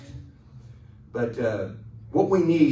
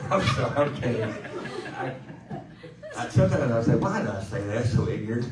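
talking through giggles; laughing apology. Real, not acted. Pastor apologising to the church through laughter. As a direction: apologise while still laughing.

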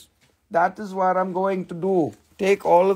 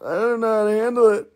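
ordinary talk, nothing marked

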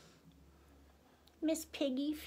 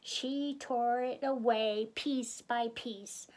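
ordinary delivery

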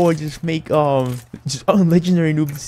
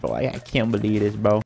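normal talking voice

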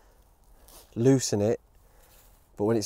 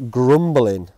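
normal talking voice

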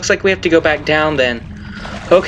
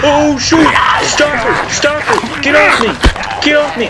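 panicked yelling; 9 semitones higher than usual. Real, not acted. Gamer grabbed by a stalker, yelling in real panic. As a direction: yell in real panic to shake it off. Stalker attacks player in game.

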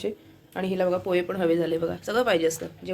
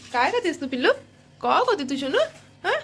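higher, doting voice for a pet; 6 semitones higher than usual. Real, not acted. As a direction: coo at the pet as she eats. Talking to pet while it eats.

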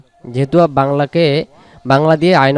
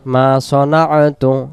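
ordinary delivery

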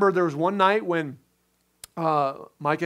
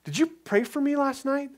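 wife asking, curious; 9 semitones higher than usual. Retold or imitated. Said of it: "Micah goes".

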